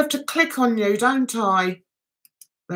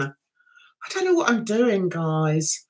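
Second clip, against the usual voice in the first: quieter, trailing off on "guys"; softer sheepish repeat; 6 semitones lower, 5 dB quieter, slower than usual. Real, not acted. Streamer admits to chat, quiet and sheepish. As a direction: drop the voice, embarrassed.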